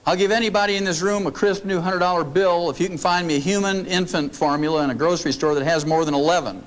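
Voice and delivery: ordinary talk, nothing marked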